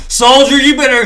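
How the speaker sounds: hyped war rally. Acted. Playing someone rallying a soldier for war, hyped and loud. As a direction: get the soldier fired up, loudly.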